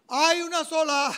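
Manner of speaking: called out high and loud; loud announcement. Retold or imitated. The examiner announcing results to the class, high and loud. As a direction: proclaim the result to the room.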